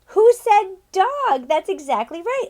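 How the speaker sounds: high, loud, slow delighted question; excited teacher. Acted. Teacher cheering a child's guess. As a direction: thrill at a right answer.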